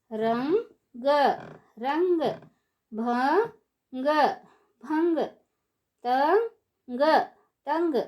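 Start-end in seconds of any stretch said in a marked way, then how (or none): none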